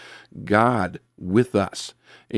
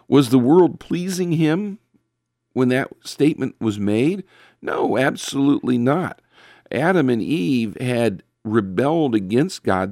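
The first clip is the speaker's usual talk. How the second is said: ordinary delivery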